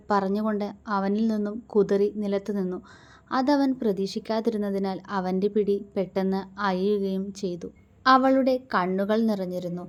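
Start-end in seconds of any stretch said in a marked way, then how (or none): none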